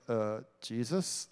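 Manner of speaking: low, slow, unsure mumbled Jesus; a stumped kid guessing the answer; clueless student guess. Retold or imitated. Student stumped by a hard question, guessing unsure. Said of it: "you're just like".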